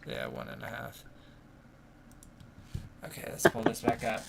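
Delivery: usual speaking voice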